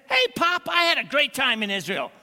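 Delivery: put-on chirpy kid home from a trip; bright excited son. Son tells his father in the joke.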